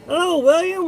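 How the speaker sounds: funny voice. Pretend. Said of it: "I need to talk in a funny voice".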